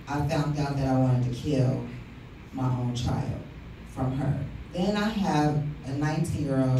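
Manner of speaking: normal talking voice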